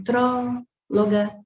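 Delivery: "tróloga" with the first syllable TRÓ held long; stretched stressed syllable. Shown as a demonstration. Teacher calling out the word to find its stress, first syllable of "tróloga" drawn out. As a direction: call out "tróloga", dragging the TRÓ. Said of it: "quando você chama as palavras".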